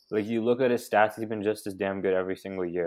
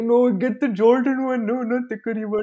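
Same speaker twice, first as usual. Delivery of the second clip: mocking friend voice; 6 dB louder than usual. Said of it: "Bro was like".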